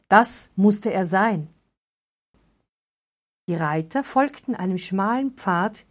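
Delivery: plain reading voice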